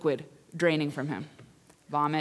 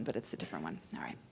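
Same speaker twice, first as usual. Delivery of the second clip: normal talking voice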